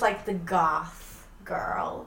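slow, near-whispered vocal fry; bored vocal fry. Shown as a demonstration. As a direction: drag it out bored and fried. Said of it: "And she talks like this".

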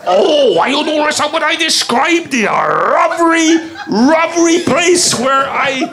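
high, slow mock Japanese accent. Contestant describing a place for guessers, in a mock Japanese accent. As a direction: stretch it out in a caricatured Japanese accent. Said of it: "It was Japan".

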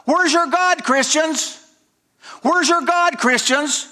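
high, jeering taunt, pitched well up and repeated; mocking taunt. Killers jeering at slaughtered Christians, high mocking taunt. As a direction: sneer it at the victims like a mob. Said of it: "the people would holler out".

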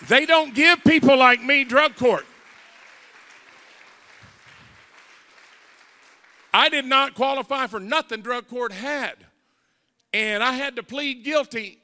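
high-powered testimony from the pulpit; emphatic preaching. Real, not acted. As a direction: drive each word home to the congregation. Preaches to congregation.

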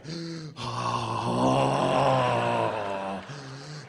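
long out-of-breath gasp; panting gasp. Retold or imitated. Mocking Koreans as out of breath, long gasp. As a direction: gasp like after a marathon. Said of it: "out of breath".

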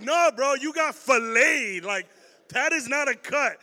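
loud, slow stunned insistence; freaked out disbelief. Retold or imitated. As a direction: shout back in disbelief at the wound. Arguing with injured kid, freaked out.

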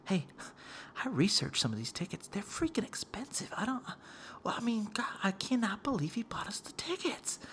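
confidential imitated whisper; on the low. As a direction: whisper it secretly to mom, amazed. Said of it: "like, on the low".

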